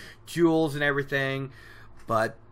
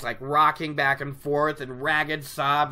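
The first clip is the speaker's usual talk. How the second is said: usual speaking voice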